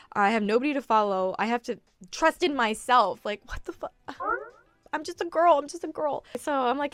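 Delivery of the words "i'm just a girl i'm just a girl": squeaky high, helpless; high little girl voice. Acted. Just a girl, high and helpless. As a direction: pitch up high like a small girl.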